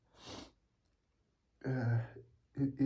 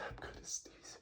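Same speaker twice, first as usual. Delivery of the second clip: faint whispered warning; whispering; 15 dB quieter than usual. Real, not acted. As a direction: drop to a whisper.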